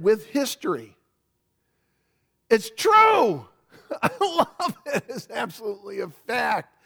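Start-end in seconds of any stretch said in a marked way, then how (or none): 2.5-3.5 high-pitched burst of preaching fervour; jubilant, much higher exclamation; exultant conviction. Real, not acted. 3.7-6.7 laughing through the words, starting high; gleeful, pitch-raised laugh in the voice; delighted laughing. Real, not acted. Delighted preacher laughs while affirming.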